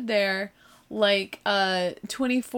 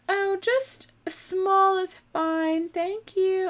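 high, slow, polite little voice; timid high-pitched thanks; meek customer; 11 semitones higher, much slower than usual. Retold or imitated. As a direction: answer meekly, high and drawn out. Meek customer answering the clerk.

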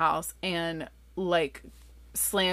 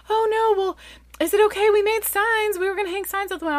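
high, louder fretting, speeding up; worried guests; 5 dB louder, faster than usual. Retold or imitated. Party guests asking mom about their signs, worried. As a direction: imitate friends anxiously checking, pitched high.

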